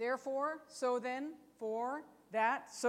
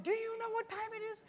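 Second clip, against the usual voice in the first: high mock-scolding mother; a quick jab as the mom; 11 semitones higher than usual. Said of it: "when your mom said".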